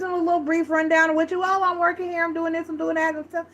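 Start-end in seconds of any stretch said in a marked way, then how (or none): 1.4-3.1 high, cheery mock voice of a guy greeting a friend; friendly greeting. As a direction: greet your buddy in a high, bright put-on voice. Guy giving brief rundown.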